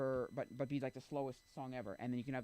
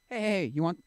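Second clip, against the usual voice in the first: calling out; 5 semitones higher, 12 dB louder than usual. Pretend. Calling out to random people.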